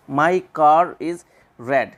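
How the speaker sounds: "car is" with the R sounded, very slowly; linking R. Shown as a demonstration. Teacher gives an example of R before a vowel, linking R. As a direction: stretch the sentence, R heard before "is". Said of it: "रे वो तभी बोलते हैं".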